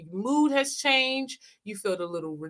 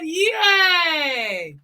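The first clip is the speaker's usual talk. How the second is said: cheer; 6 semitones higher, 4 dB louder than usual. Acted. Cheering after exercise.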